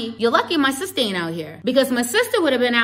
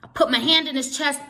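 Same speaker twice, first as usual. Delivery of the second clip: ordinary delivery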